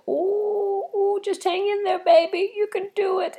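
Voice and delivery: very high cooing self-talk; coaxing herself. Retold or imitated. Coaxing herself through the flight's last minutes, high and cooing. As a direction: coo to yourself like soothing a baby. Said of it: "I was like".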